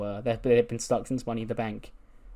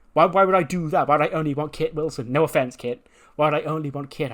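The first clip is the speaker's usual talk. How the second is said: higher, protesting mock outrage; indignant denial; 4 semitones higher, 4 dB louder than usual. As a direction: protest your innocence in rapid questions. Indignant denial in retold quarrel.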